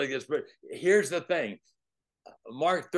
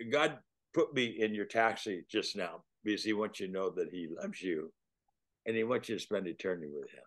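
tender plea; 6 semitones lower, 7 dB quieter than usual. Retold or imitated. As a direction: ease the words to him gently. Tender plea to driver, quiet.

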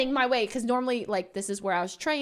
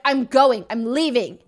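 retold angry outburst; 3 semitones higher, 9 dB louder than usual. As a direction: reenact storming out of a fight. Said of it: "We got into a horrible fight".

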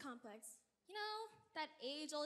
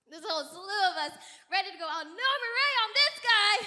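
rallying call; 12 dB louder than usual. Acted. Rallying the princesses.

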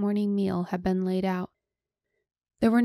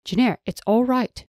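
higher, louder, quick calming; hurried reassurance; 5 semitones higher, 6 dB louder than usual. Acted. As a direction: rush to calm her down. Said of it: "Knistrith hurriedly assured her".

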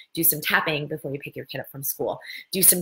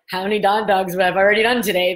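amused quote; 6 dB louder than usual. Retold or imitated. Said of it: "I started laughing".

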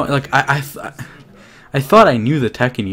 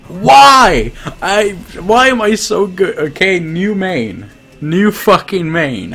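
higher, louder, slow incredulous exclaiming; thrilled disbelief, higher and louder; real excited disbelief; 10 semitones higher, 7 dB louder, slower than usual. Real, not acted. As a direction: marvel out loud at your own winning streak. Shocked at winning so easily.

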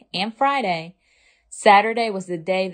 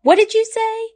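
yelling. Acted. Mother yelling to Ava over the noisy park. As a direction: shout to be heard in a noisy park. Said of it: "yelled her mother".

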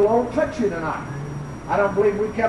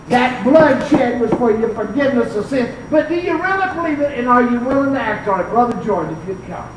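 normal talking voice

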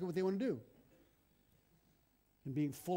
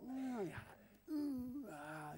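mock hedging; 5 semitones higher, 10 dB quieter, much slower than usual. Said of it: "When I go".

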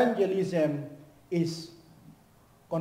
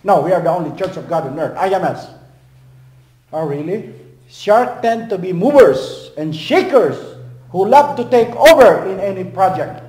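preaching at full force; 11 dB louder than usual. Acted. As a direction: thunder it from the pulpit.

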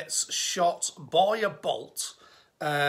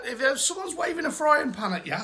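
ordinary talk, nothing marked

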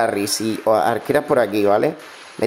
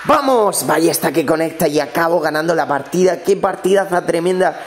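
excited, higher-pitched cheer without shouting; real thrill, reined in; held-back celebration; 4 semitones higher than usual. Real, not acted. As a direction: celebrate the win but keep from screaming. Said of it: "qué pena que no pueda gritar".

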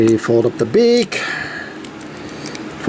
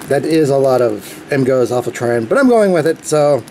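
usual speaking voice

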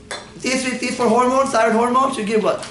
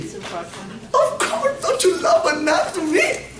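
teasing laugh; 8 semitones higher, much slower than usual. Acted.

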